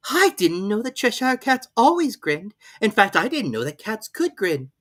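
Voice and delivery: put-on high, pleading girl's voice; a polite girl asking; young Alice. A narrator's reading. Alice figure voice.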